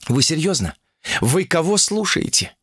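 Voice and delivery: high-pitched incredulous appeal; appealing to reason. Acted. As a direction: try to talk sense into them. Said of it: "попыталась воззвать к коллективному разуму". (взывая к разуму)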